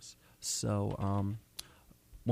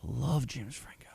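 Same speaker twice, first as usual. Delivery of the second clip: whispered; 2 semitones higher, slower than usual. Real, not acted. Fond aside.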